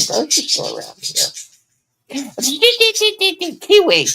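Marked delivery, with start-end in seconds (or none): 2.1-3.5 a high squealing laugh; bright burst of laughter. Real, not acted. Laughs at the bird. 3.6-4.1 loud high call to the bird. Real, not acted. Scolding the noisy bird.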